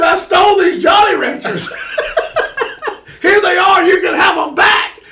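high, loud, half-laughing wail; retold wailing. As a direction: cry it out loud while laughing at yourself. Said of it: "And I'm wailing, crying, man".

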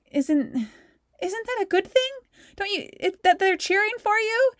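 high, disbelieving squeak; mock-baffled high voice; incredulous. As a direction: ask it as if it makes no sense. Incredulous answer to teammate.

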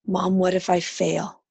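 ordinary delivery

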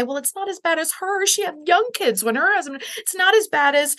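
high, brushing-off imitation; mock comparing pain. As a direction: play down the pain, pitched well up. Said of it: "we wanna wish it away and say".

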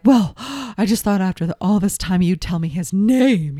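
mock exasperated client with a gasp; huffy imitation of an annoyed client; pissed-off huff. Daughter demanding his name from the reader, imitated huffily. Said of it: "She's all pissed off".

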